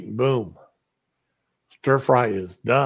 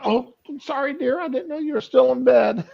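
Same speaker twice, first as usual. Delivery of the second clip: wife's voice; 12 semitones higher, 4 dB louder than usual. Retold or imitated. Wife voiced in retold story.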